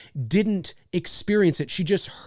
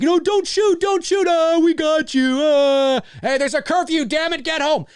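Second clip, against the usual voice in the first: much higher and louder, hammed-up goofy cops; mocking cops yelling; 11 semitones higher, 5 dB louder than usual. Cops in the Halloween four scene shouting at the house, hammed-up and much higher. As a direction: yell it like the prank-caller cops in Halloween four. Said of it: "And then they're like".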